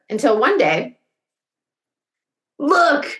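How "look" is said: bright excited call, pitched up; excited cry. Acted. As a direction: call out, pointing at something. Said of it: "cried Jonathan".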